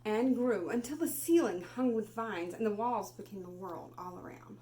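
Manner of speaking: put-on expressive read-aloud for children; storybook reading. Teacher reading Where the Wild Things Are to class, expressive.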